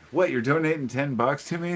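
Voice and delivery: surprised. Real, not acted. Surprised by donation message.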